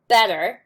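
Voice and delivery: "better" with a loud BET and a lighter second syllable; first-syllable stress. Shown as a demonstration. Teacher showing accent on the first, not second, first-syllable stress. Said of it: "takes accent on the first and not second".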